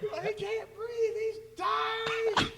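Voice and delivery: high, stretched mock wail through laughter; imitated panic. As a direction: mimic a frantic parent while cracking up. Replaying the panicked parent, laughing.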